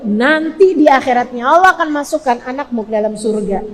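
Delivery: ordinary talk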